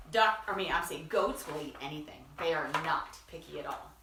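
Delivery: plain reading voice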